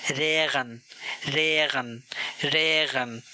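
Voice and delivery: रेगन with the r from the throat; रेगन opened on a guttural r; throaty r. Shown as a demonstration. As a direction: roll the start of रेगन in the throat. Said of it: "थोड़ा throat से आवाज़ निकालनी होती है".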